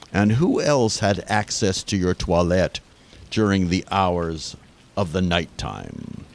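put-on hammy French detective accent.

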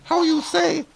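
very high squeaky femme fatale voice; falsetto lady voice. Acted.

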